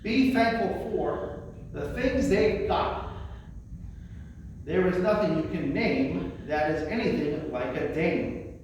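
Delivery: normal talking voice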